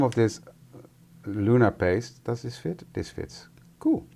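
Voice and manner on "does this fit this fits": whispered aside. Real, not acted. Muttering to herself.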